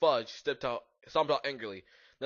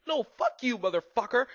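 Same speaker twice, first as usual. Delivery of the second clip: angry retort; 10 semitones higher, 4 dB louder than usual. A narrator's reading. Acted quarrel between rivals.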